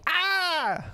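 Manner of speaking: high, yelped scream; a sudden high shriek; mock scream. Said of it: "she's like".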